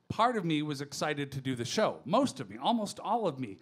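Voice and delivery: normal talking voice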